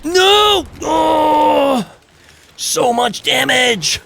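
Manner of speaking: high, drawn-out cry of dismay; dismayed wail. Real, not acted. Player watching his character take heavy damage, dismayed. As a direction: wail in real gaming panic.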